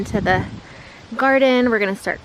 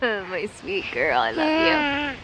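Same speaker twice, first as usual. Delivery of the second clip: sweet nothings; 5 semitones higher, 4 dB quieter than usual. Real, not acted. Mother cuddling her little girl.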